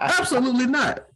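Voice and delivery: high, loud flat refusal; loud denial. Real, not acted. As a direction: shut the idea down loud. Denying being the country one.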